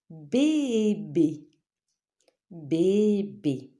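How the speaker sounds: "Bébé" as two drawn-out syllables. Shown as a demonstration. Teacher naming a picture for pupils, syllable by syllable. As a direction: stretch the first BÉ, then the second.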